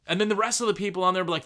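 ordinary delivery

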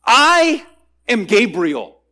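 a high, loud, booming "I", then "am Gabriel"; towering declaration of identity; emphatic proclamation. Acted. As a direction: announce your name like an archangel. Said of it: "very emphatic response by Gabriel".

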